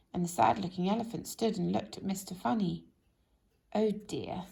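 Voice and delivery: plain reading voice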